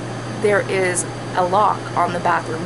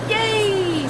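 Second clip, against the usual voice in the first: little cheer; 11 semitones higher than usual. Real, not acted. Cheering about sleeping in.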